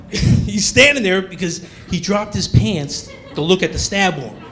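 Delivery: normal talking voice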